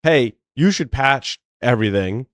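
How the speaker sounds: ordinary delivery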